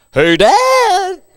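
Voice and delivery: put-on high, loud breaking teen voice; cracking voice. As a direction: let the voice crack mid-change. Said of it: "It's like the schizo voice".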